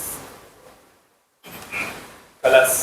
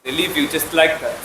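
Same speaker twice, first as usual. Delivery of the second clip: ordinary talk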